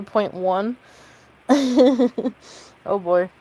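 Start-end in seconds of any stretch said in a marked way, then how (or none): 1.5-2.3 brief loud chuckle; self-mocking laugh. Real, not acted. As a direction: laugh at yourself. Laughing at own joke.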